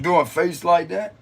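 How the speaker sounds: ordinary talk, nothing marked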